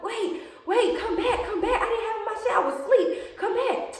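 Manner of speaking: high, laughing call up the street; laughing yell. Retold or imitated. Shouting after girls walking up the street, laughing. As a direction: shout them back while laughing. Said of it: "I'm screaming up the street".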